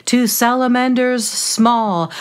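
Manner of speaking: plain reading voice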